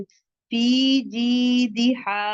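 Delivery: फी जीदिहा with फी and जी held two counts; long vowels held. Shown as a demonstration. Teacher models two-count madd in फी जीदिहा. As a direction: stretch both long i's in फी जीदिहा. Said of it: "टू count पढ़ेंगे".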